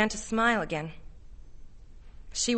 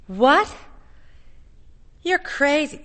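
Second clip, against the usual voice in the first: high, loud disbelief; startled, rising outcry; shocked; 6 semitones higher, 5 dB louder, slower than usual. A narrator's reading. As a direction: exclaim it in alarm, pitch jumping up. Connie reacts in shock to Arnold.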